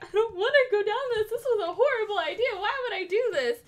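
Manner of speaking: freaking out. Retold or imitated. At the steep edge she refuses Zach, high and panicked. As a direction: gabble it in a frightened rush. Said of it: "So I'm freaking out".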